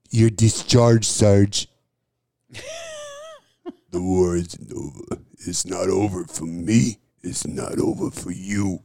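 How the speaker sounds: grim soldier who won't stand down; Dolph movie quote. Retold or imitated. Quoting Universal Soldier to a friend, low menace. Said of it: "going back and forth with Jean-Claude".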